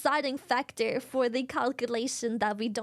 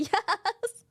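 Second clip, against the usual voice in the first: usual speaking voice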